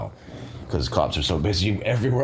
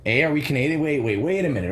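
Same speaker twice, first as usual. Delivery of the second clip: ordinary delivery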